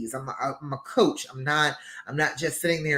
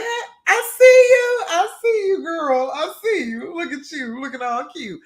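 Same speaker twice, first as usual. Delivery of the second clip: much higher and louder, squealing praise; gushing compliment; 12 semitones higher, 7 dB louder than usual. Pretend. Complimenting a woman who looks great, squealing with delight. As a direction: hype her up like your best friend. Cheering a stranger's look.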